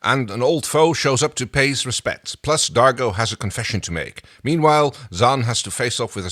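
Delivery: reading a listener's summary aloud with flair; listener synopsis read. Acted. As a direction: read it out like a show intro.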